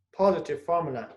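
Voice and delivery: ordinary delivery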